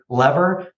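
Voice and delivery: "lever" said LEV-er, short e; short-e pronunciation. Shown as a demonstration. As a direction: say "lever" to rhyme with never. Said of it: "I consistently say lever".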